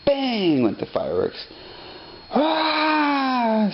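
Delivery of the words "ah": put-on baby scream. A narrator's reading. Narrator voicing the baby frightened by fireworks, high scream. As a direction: shriek like a startled baby. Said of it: "screamed the baby".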